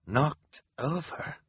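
slow, high, drawn-out question; incredulous repetition. A narrator's reading. Hal repeating Klaus's words back, slow and incredulous. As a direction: echo it slowly in disbelief. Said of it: "Hal repeated".

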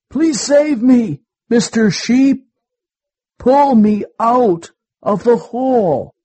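high, loud plea for rescue; loud pleading. A narrator's reading. Trapped wolf begs a passing sheep, loud pleading. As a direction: beg loudly, pitched well up.

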